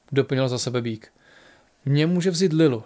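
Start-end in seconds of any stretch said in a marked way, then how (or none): none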